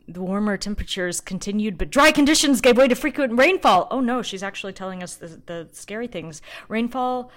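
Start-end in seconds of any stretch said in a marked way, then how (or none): 0.1-3.9 reading report aloud. Acted.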